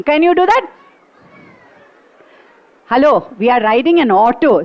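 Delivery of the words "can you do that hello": ordinary delivery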